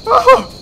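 high, loud startled cry; startled yelp. Real, not acted. Player jumping at the scare, startled yelp. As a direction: yelp in sudden fright.

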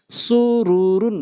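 drawn-out syllable by syllable reading; slow demo. Shown as a demonstration. Teacher speaking so learners can follow, slowly. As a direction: stretch each syllable for learners. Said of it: "slow বলছি এই জন্য".

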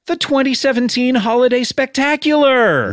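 high, excited big-reveal announcement; hyped show host introduction; announcer. Pretend. Host announces special and cast list.

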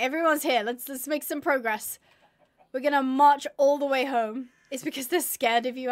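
normal talking voice

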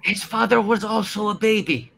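lightly voiced baby-man. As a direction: say it like a big baby. Silly line in put-on voice.

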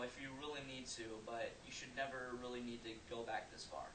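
faint, distant voice from far back; far from mic. Real, not acted. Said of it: "now I'm twenty feet away".

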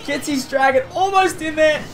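ordinary delivery

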